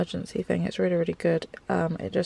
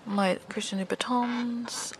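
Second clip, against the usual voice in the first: whisper; 4 semitones higher, 9 dB quieter than usual. Real, not acted. Hushed reveal of favourite shoes.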